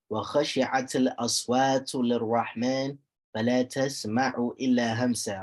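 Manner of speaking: quick, flowing recited verse; quick tajweed recitation; Quran recitation. Shown as a demonstration. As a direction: chant the ayah fast in one breath.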